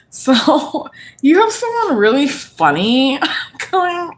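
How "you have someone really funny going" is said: words broken by real laughter, higher; laughing fit mid-reading. Real, not acted. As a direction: giggle right through the line. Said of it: "I can't stop laughing right now".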